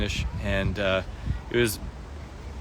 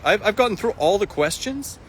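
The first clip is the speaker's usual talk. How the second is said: much higher, rising are-you-serious; surprised disbelief; 13 semitones higher than usual. Real, not acted. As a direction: genuinely caught off guard. Surprised all questions answered.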